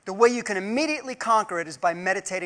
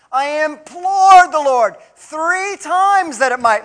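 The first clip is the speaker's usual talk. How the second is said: slow, louder, drawn-out "implored"; stress on implored; 5 semitones higher, 6 dB louder, slower than usual. Shown as a demonstration. Preacher reading Paul, stressing "implored". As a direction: stretch "implored" out long and heavy. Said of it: "look up the word".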